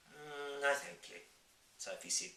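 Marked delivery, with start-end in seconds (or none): 0.1-1.2 put-on young girl voice. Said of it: "backing away".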